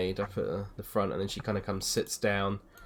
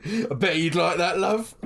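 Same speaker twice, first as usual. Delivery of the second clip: put-on leering drunk; 10 semitones higher, slower than usual. Drunk villager leering at the woman, high with a laugh. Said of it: "and he's like".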